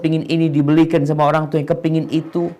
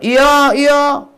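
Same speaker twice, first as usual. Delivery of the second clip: higher and louder, impatient mock yes-yes; high-pitched grudging yes; 11 semitones higher, 5 dB louder than usual. Preacher imitating a child answering parents, high and impatient. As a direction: snap a reluctant yes up high. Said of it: "Tapi nadanya tinggi".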